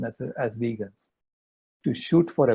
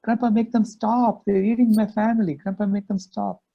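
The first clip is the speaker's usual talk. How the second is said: higher, pleading child's wail, lightly voiced; imitating crying child; 8 semitones higher than usual. Grandpa voicing the six-year-old begging him, tearful. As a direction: plead like a little girl in tears, mock sobbing. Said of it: "she started bawling".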